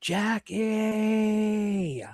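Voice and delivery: Jackie stretched long and high; name dragged out. Retold or imitated. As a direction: drag out Jackie like a scolding mom. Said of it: "My name dragged out way longer".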